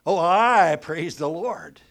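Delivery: slow, "I" leaned on in "Oh, I praise the Lord"; stress on I. Shown as a demonstration. Preacher mocking praise centred on self, "I" stressed. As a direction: drag it out, weight on "I". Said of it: "Is the emphasis on the I?".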